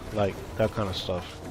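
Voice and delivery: ordinary delivery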